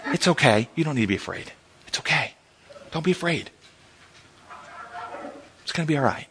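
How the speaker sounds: put-on soft, soothing words to a crying child; comforting parent. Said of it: "what's the parent say".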